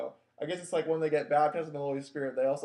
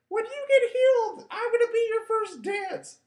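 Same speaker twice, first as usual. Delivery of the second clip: mock teen girl; 5 dB louder than usual. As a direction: squeak it like a giddy teen girl.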